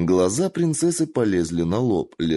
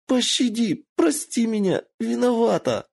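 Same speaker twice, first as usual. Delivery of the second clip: begging in pain. A narrator's reading. Kitsune crushed by Michiyo, squealing for mercy.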